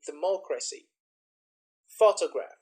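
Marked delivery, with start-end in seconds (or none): 1.9-2.6 "photograph" punched loud on "pho"; stress on first syllable. Shown as a demonstration. Teacher showing stress shift to students, first-syllable stress. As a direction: hit the first syllable of "photograph", then drop. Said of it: "you can hear the stress in the first".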